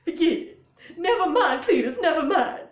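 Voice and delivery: high, loud flustered mama; hammed-up high character voice, dismissing her man; Mama Klump. Mama telling her husband forget it, high loud voice. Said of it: "to get that sound to come out".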